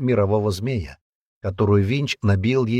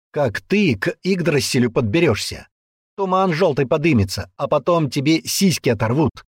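raised, goading voice; taunting man; 7 semitones higher, 4 dB louder than usual. Acted. Man goading the girl toward the tree, heated taunting. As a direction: dare her with grisly warnings.

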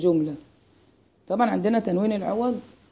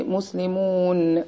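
very slow مسلمون with a long held ending; مسلمون said slowly, long "uu" into n; drawn-out demonstration; much slower than usual. Shown as a demonstration. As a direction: stretch مسلمون out to show its final noon. Shows the noon in the plural.

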